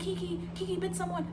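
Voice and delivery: high-pitched retold alarm; panicked report. Terrified cousin phoning the mom, panicked. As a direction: imitate a frightened cousin breaking bad news. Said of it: "so terrified".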